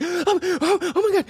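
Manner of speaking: high, gasping imitation of a crying nurse; mock hyperventilating. School nurse seeing a broken arm, hyperventilating. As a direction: gasp it in bursts, pitch way up. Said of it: "she's crying and hyperventilating".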